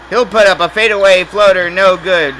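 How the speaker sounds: excited call. Real, not acted. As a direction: build to the shot. Missed floater call.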